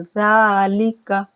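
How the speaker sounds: ज़ालिका with ज़ा drawn out; slow recitation; long za. Shown as a demonstration. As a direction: stretch ज़ा, keep लिका short. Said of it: "दोनों को नहीं खींचना है".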